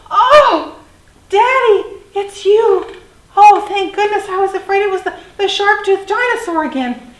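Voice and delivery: gasp, then very high, loud delight; relieved little dinosaur. A narrator's reading. Little dinosaur finally sees his daddy, high excited relief. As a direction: gush with relief in a child voice.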